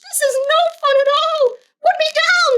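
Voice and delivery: very high squeaky cry of alarm; panicked fish. Acted. Fish dangling in the air, squealing high. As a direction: shriek to be put down. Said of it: "said the fish".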